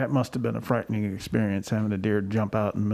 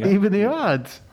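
much higher and louder, slow; a hammed-up, slow call to even the odds; mock rallying cry; 9 semitones higher, 5 dB louder, slower than usual. Joking about giving animals weapons, a mock rallying cry.

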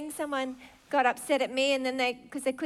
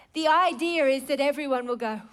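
ordinary talk, nothing marked

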